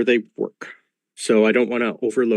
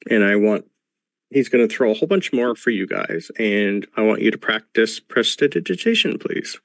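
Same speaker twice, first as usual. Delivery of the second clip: normal talking voice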